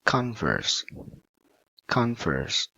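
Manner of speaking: "Converse" with CON high, then falling; stress on first syllable. Shown as a demonstration. Teacher models the noun for students, high CON in "Converse". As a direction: lift CON, let VERSE drop in "Converse". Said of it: "So put on the first syllable".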